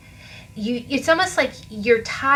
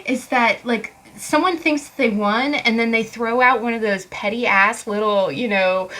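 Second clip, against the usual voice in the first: usual speaking voice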